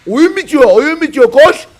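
high, loud alarm call; urgent yelling. Real, not acted. Caster warns the game is ending, urgent yelling. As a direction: shout them into running.

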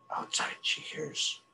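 whispered, slow and tense; hushed narration. A narrator's reading. Narrator builds tension as the mink listens, hushed whisper.

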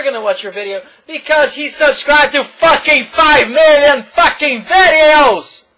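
shouting, high-pitched rant; real loud rant about subscriber counts. Real, not acted. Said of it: "I guess I'm too loud".

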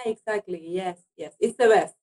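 ordinary talk, nothing marked

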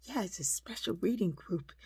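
put-on mildly excited child retelling; excited little girl. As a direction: imitate a kid pleased with her news. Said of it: "she was kinda, like, excited".

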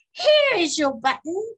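high, loud happy find; eager high-pitched cry; excited cry. A narrator's reading. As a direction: call out the discovery in a high voice. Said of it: "cried Frog".

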